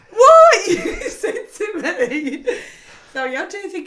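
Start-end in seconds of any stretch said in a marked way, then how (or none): 0.1-0.7 much higher and louder, mock-stunned yelp; hammed-up disbelieving shriek; retold shock. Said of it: "And I'm like". 0.7-2.5 laughing. Real, not acted. Laughing at the friend's reversal.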